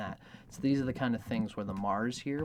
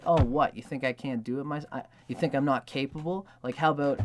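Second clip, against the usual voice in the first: louder, higher mock-indignant retort; a lover's cheeky counterattack; feisty comeback; 4 semitones higher, 7 dB louder than usual. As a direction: fire back playfully, pretending to be offended. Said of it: "would love if that woman would be like".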